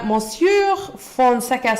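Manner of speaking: slow, lightly French "Monsieur Fonseca"; a playful hint of a French accent. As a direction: roll the name out in a mock French accent. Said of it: "I'm saying that with a French accent".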